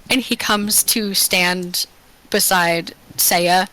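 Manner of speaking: normal talking voice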